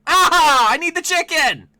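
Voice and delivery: high, loud pleading cry; frantic yell. Real, not acted. Gamer begging the pinball game for a chicken, yelling. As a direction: scream for the chicken.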